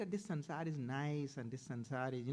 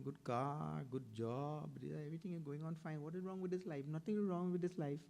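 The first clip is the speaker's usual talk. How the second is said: high, smug worldly householder voice; a hint of a cheerful family man defending his life; people who say life is fine; 6 semitones higher than usual. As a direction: mimic a contented man brushing off the Swamiji. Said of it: "we say".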